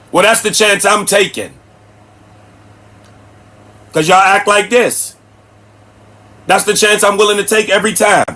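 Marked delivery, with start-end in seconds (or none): none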